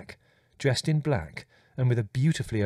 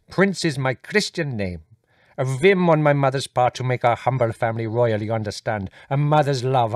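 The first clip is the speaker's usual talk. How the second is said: gushing courtly visitor, higher and eager; effusive Indian guest; 3 semitones higher, 6 dB louder than usual. Acted. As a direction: flatter each family member in turn. Voices Gigi apologising.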